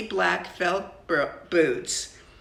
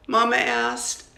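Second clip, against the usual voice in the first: usual speaking voice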